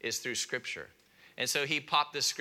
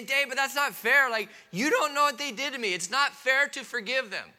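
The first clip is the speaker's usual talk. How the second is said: high, loud, indignant objection, not fair; protesting listener; 11 semitones higher, 6 dB louder than usual. Retold or imitated. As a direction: voice the hurt listener's protest, pitched up. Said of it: "And you may say".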